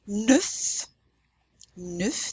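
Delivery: "Neuf" as French nuhf, F clearly sounded, rising; final F pronounced. Shown as a demonstration. Teacher models the number nine, "Neuf" with its F. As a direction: end French "Neuf" on a full F. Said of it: "You pronounce that F".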